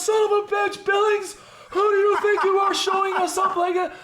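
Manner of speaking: high cursing yell; captain's furious shout. Retold or imitated. Captain nearly falls in the mud, yells at Billings. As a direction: blow up at Billings after nearly falling. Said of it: "And he goes".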